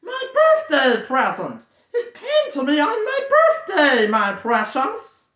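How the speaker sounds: very high, loud, slow cackling whine; gloating Gollum. Acted. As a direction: gloat over the precious. Gollum gloating over ring.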